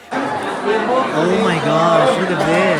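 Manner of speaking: slow drawn-out amazement; awed. Real, not acted. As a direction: stretch it out in wonder.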